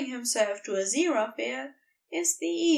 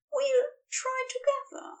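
higher angel voice, hesitant; Aziraphale's voice; 14 semitones higher than usual. Acted. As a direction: lift into the angel's voice, unsure. Aziraphale voiced, answering Crowley.

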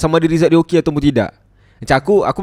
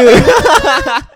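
loud laugh; 12 semitones higher, 8 dB louder than usual. Real, not acted. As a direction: burst of high laughter. Laughing at own joke. (gelak kuat)

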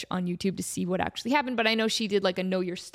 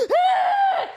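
high, loud fangirl scream; 6 dB louder than usual. Retold or imitated. Fan hearing her idol on the phone, shrieking. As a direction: shriek with joy. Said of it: "I just screamed at the top of my lungs".

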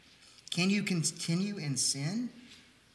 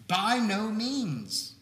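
by no means drawn out very slowly and pitched higher; strongest emphasis; 6 semitones higher, 3 dB louder, much slower than usual. Shown as a demonstration. The preacher shows Paul's emphatic Greek with slow, heavy stress. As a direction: lean on by no means as hard as you can. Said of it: "In the strongest terms possible".

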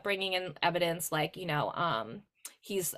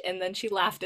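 normal talking voice

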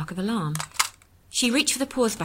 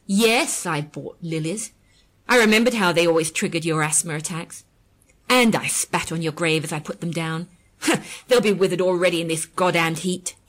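bitter; 6 dB louder than usual. A narrator's reading. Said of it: "she added bitterly".

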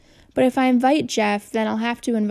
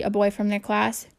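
ordinary delivery, nothing marked

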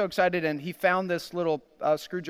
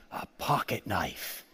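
retold hushed wish; 11 semitones lower, 8 dB quieter, slower than usual. Son's hushed Christmas wish, retold.

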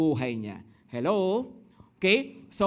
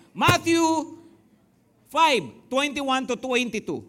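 loud verse call; 4 semitones higher, 6 dB louder, slower than usual. Real, not acted. Announcing the verse.

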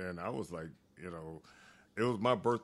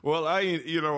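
high breathy laugh into speech; laugh, then push on louder; loud high laugh, then talk; 8 semitones higher, 9 dB louder than usual. Real, not acted. Laughs at horse story.

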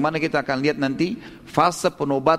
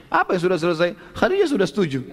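higher, lively question and answer; retold eager exchange; 6 semitones higher than usual. Nafisa brings Muhammad word of Khadijah's consent, mildly excited. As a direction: lift the voice for the good news. Acts out exchange announcing good news.

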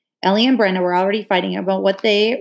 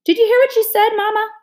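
much higher, loud shrill appeal; outraged tattling; 14 semitones higher, 5 dB louder than usual. A narrator's reading. Brenda tattling to Mama about Maybelle, outraged. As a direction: cry out to Mama in indignation.

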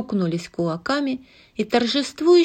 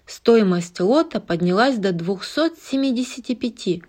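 usual speaking voice

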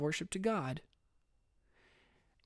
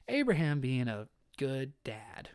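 ordinary talk, nothing marked

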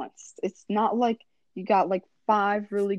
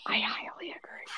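soft unvoiced whisper; 11 dB quieter than usual. Real, not acted. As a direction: whisper your agreement. Whispered agreement.

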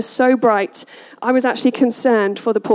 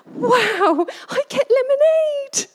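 put-on much higher delighted kid voice; excited child; 12 semitones higher, slower than usual. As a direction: squeal it like a thrilled little boy. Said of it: "He's like".

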